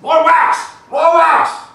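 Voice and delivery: loud high shout of an excited dad; yelling. Retold or imitated. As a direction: bellow it across the water. Dad yelling from boat, retold.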